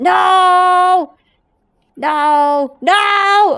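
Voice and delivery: high, loud yelps; alarmed shouting. Real, not acted. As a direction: shout as it all goes wrong. Game goes wrong while rotating gnome.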